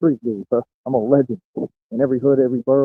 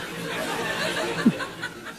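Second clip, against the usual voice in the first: airy laugh; 10 dB quieter than usual. Real, not acted. Breathy laugh after joke.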